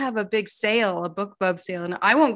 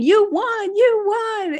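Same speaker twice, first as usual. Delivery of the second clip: high, loud imitation of a friend's scream; excited screaming; 12 semitones higher, 5 dB louder, much slower than usual. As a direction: mock-scream the good news down the phone. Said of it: "she calls me from the ceremony screaming".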